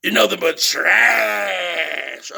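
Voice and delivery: slow drawn-out mock shout of the insult; hammed-up copy of his rival's line; imitating Mark's catchphrase. Vlogger mocking his rival's catchphrase, slow mock shout. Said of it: "made it his own a little bit".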